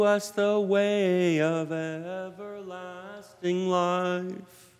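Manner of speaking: slow, solemn, lifted prayer voice; formal liturgical prayer. Acted. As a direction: proclaim it like a solemn priest. Presider prays the eucharistic preface.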